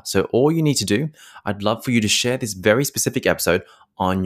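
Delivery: loud, upbeat promo read. Acted. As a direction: pitch the podcast like an announcer.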